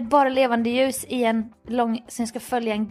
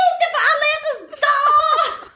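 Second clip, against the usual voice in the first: hammed-up high, drawn-out bawling; mock sobbing protest, very high and slow; tearful wail; 3 dB louder, slower than usual. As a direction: wail it in exaggerated heartbreak. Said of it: "Alltså verkligen grät".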